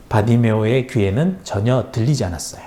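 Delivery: plain reading voice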